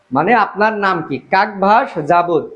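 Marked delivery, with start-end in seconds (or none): none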